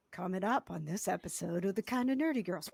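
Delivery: low, soft announcer intro; hushed, low podcast promo read; show intro voice. Shown as a demonstration. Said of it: "Maybe I'll do a, like".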